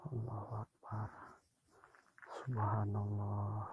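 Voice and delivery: hushed, breathless prayer under his breath; frightened whisper. Real, not acted. Ghost hunter praying to himself in the dark, frightened whisper. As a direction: whisper it so nothing out there hears.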